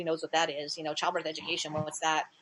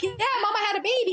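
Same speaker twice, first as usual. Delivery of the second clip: quick, high playful tease; older sisters teasing their little brother, playful; 13 semitones higher, 3 dB louder, faster than usual. Retold or imitated. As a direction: rattle it off to fool him.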